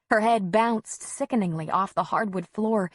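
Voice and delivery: ordinary delivery, nothing marked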